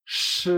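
SH held as a hush, without an "ee"; retroflex sh. Shown as a demonstration. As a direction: draw out SH with no i sound. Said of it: "No pronunciamos la I".

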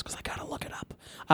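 whispered aside to self; whispering. Real, not acted. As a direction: breathe it under your breath. Muttered aside while searching phone.

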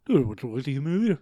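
put-on fast, garbled made-up accent, words barely formed; mumbled, made-up Yupian accent for an invitation to dinner. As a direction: rattle it off in an invented foreign accent. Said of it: "I have no idea what that was".

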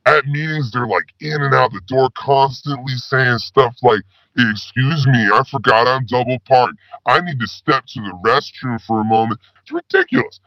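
normal talking voice

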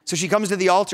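normal talking voice

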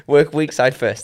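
slow, loud words through giggles; laughing. Real, not acted. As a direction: fight the laughter to get it out. Carries the joke on, laughing.

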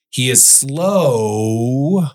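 SLOW drawn way out with a swooping pitch; read slow. Shown as a demonstration. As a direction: drag out SLOW very long. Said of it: "I'm gonna read that slow".

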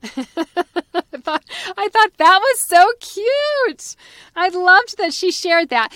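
high and loud through a laugh; giggling, tickled by the story; laughing delight. Real, not acted. Host reacting to the grandpa's joke, laughing.

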